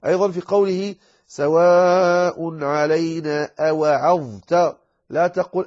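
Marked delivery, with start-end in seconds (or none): 1.3-4.8 أوعظت slow, dha fully voiced; clear dha. Shown as a demonstration. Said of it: "عليك أن تظهرها".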